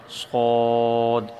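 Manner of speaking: صاد drawn out long and heavy; model Arabic letter. Shown as a demonstration. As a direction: hold صاد slowly with a full round mouth. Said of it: "صاد کے بعد الف لگا کر اس کو پڑھا جائے".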